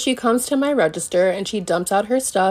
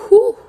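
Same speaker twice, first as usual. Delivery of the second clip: sharp high scornful "whew", much louder; mocking whistle-like whew; 13 semitones higher, 9 dB louder than usual. Customer mocking the cashier, loud scornful whew. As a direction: blow it out like a dismissive taunt. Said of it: "She's like".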